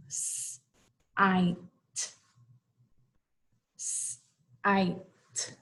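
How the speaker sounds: "S-I-T" as separate sounds: sss, long "eye", t; sounded out. Shown as a demonstration. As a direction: break "S-I-T" into slow sounds with pauses. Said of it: "that's going to make the S sound".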